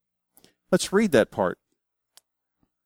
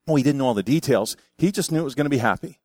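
ordinary delivery

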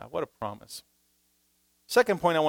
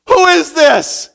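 high, loud, slow shocked cry; startled Saul; 14 semitones higher, 15 dB louder, slower than usual. Retold or imitated. Saul knocked down asking who speaks, shocked high cry. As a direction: blurt it in terror and confusion. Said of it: "his first response would have been".

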